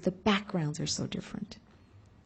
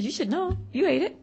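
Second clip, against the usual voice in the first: higher, playful gotcha; spring the joke on her lightly; teasing son, lightly voiced; 7 semitones higher than usual. Son reminds her she ate it, teasing.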